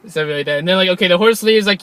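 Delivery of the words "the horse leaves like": ordinary talk, nothing marked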